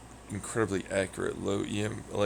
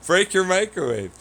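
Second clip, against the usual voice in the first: mocking voice; 8 semitones higher, 6 dB louder than usual. Mocking the chat's taunt.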